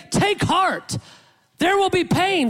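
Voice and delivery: high, emphatic imagined reassurance; high-pitched mock encouragement; mock pep talk. Voicing what the Lord doesn't say.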